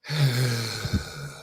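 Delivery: heavy, drawn-out sigh; imitated dog sigh. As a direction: let out a huge sigh like the dog. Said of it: "the dog literally just went".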